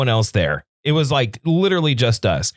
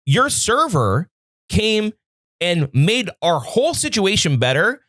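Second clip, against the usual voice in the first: higher, heated retelling of going off; retold rant; 6 semitones higher than usual. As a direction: replay the angry rant at the manager. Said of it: "I went fucking crazy".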